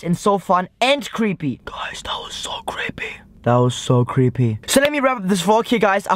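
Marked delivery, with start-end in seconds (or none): 1.7-3.1 whispered confidingly to the viewers; creeped-out whisper. Real, not acted. 3.4-4.6 voiced, pitched well down; low creeped-out repeat. Real, not acted. Repeats the whispered line voiced.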